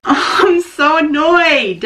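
slow, high laugh-cry of annoyance; half-laughing, half-crying over the blazer; laughing frustration. Real, not acted. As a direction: laugh through the annoyance, drawn out. Boobs hanging out of blazer.

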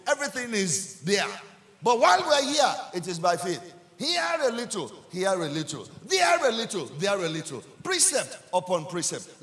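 loud, high attack on each opening phrase, then easing; full-force preaching. Real, not acted. Preacher urging the congregation to walk by faith, full-force.

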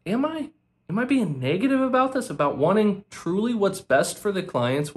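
incredulous rhetorical question. Acted. To critics calling him negative: high incredulous am I. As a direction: toss the question back as if it's absurd.

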